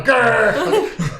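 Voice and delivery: loud playful grr; panther growl. Acted. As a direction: growl like a big cat. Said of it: "That's my panther growl now".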